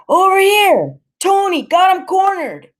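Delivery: high, loud shout to his pals; calling out. Acted. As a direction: yell to his gang across the street. Gang punk calling out.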